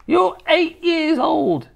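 high, slow, scornful grandma; snorted scorn. A narrator's reading. Grandma to her eight-year-old grandson, scornful. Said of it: "she snorted".

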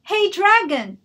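very loud, high yell; loud shout. Acted. Elizabeth shouting into the sleeping dragon's ear, loud. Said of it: "She shouted as loud".

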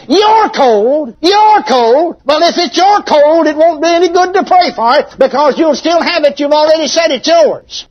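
high, loud, hammering rebuke; booming preacher voice. Retold or imitated. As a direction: boom it out like an old-time preacher without a microphone. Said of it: "she just had a loud booming voice".

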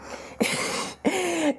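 high bright burst of laughter; a short real giggle. Real, not acted. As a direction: laugh it off, amused at herself. Laughs at her own overreaction.

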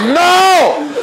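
high, loud cry of joy; hammed-up shriek of relief; mock yell. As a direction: shout it out in triumph. Retold shout.

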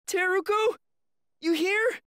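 calling out. Acted. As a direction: call out for someone lost. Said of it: "You can't go around yelling like that".

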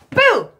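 loud high mock scary boo; playful boo. Teacher pretending to scare the children with boo. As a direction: pop out with a pretend fright. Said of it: "Did I scare you?".

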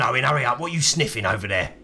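normal talking voice

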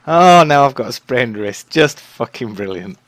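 loud sarcastic outburst; angry shout. Real, not acted. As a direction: yell it in disgust. Injured in game, furious.

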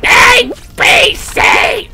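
high, loud, drawn-out shout; excited yell. Real, not acted. Streamer hollering letters mid-game, excited yell. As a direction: belt out each letter, high and stretched.

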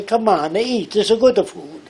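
high, loud put-on Italian accent. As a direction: play up an Italian family's accent. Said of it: "if you're brought up in Italy".

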